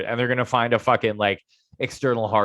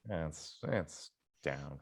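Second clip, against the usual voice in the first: very soft, low and defeated; deflated murmur; 7 semitones lower, 18 dB quieter, slower than usual. Real, not acted. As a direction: let it sink out of you. Crypto portfolio is down.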